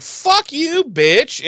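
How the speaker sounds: high-pitched acted outrage; mock indignant girlfriend. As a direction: fire back as the insulted girlfriend. Acted quarrel, girlfriend retorts.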